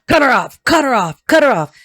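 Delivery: loud, high, furious repeated commands; angry shout. Acted. Channeled message, shouted repeatedly.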